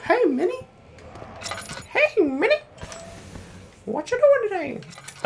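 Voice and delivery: high squeaky cartoon mouse, slow; Mickey Mouse voice. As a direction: imitate Mickey greeting Minnie. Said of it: "He was like".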